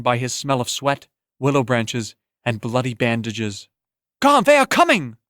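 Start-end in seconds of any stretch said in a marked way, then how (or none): 4.2-5.2 high, loud urgent warning; urgent shout. Acted. A warning cried to Garn that the riders come, loud. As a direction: cry out that danger is coming.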